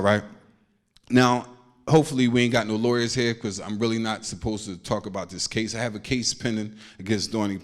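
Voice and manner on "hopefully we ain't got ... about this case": ordinary delivery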